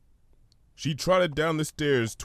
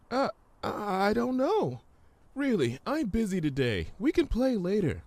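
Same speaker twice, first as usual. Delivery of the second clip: much higher, gentle pony voice; Fluttershy; 11 semitones higher than usual. Acted. Fluttershy answering the hummingbird, high and gentle.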